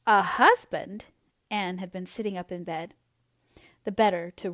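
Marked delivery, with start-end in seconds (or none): 0.1-1.1 slow, loud disbelief; drawn-out shocked echo; stunned surprise. A narrator's reading. Anne stunned by proposal.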